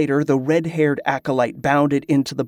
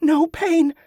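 put-on very high, elated girl's voice; high girlish exclamation; overjoyed relief. A narrator's reading. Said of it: "A great shuddering laugh came from her".

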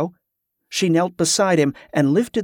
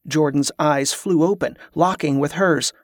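usual speaking voice

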